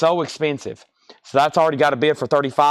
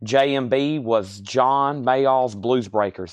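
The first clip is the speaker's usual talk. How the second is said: normal talking voice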